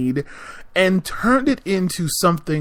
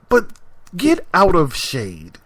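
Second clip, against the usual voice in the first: normal talking voice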